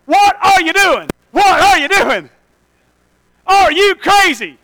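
loud, high, slow disbelief; incredulous shout. Retold or imitated. Apostles yelling at Jesus in disbelief. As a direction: yell like friends who think he's lost it.

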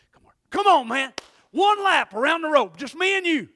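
a buddy shouting a challenge at his friend to race; egging on. Retold or imitated. Buddy daring him to race, shouting. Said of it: "all of a sudden he goes".

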